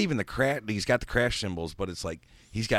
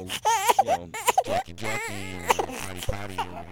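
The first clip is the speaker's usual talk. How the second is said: very high crying whine; whiny falsetto; 4 dB louder than usual. Pretend. Friend hamming up a begging plea for a ride, whiny falsetto. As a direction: whimper it in a silly falsetto.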